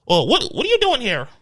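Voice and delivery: high-pitched imitation of a nosy Karen; mock Karen. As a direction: challenge a stranger like an entitled busybody. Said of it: "the Karens".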